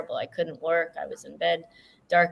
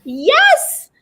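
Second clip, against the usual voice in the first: much higher, louder, gleeful yes; thrilled; 13 semitones higher, 8 dB louder, slower than usual. Real, not acted. [name] cheers for her favorite author, high and loud. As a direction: burst out in real delight.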